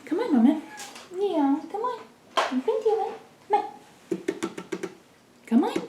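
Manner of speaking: high cooing encouragement. Real, not acted. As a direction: cheer the cat on, very high. Coaxing the cat to jump.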